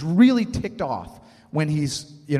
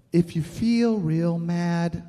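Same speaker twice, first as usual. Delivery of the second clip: put-on slow, spoken children's song; song recited; slower than usual. Father quoting a preschool show song, slow spoken rhyme. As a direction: recite the lyric slowly like a nursery tune. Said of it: "there's a song in Daniel Tiger's Neighborhood".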